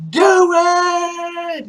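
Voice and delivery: very high, loud, drawn-out scream; acted screamed dare. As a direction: imitate screaming a desperate challenge. Retold woman daring her attacker again.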